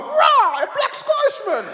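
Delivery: very high mock-astonished youth; imitated youth's high-pitched amazement; amazed youth. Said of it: "one of the youth was like".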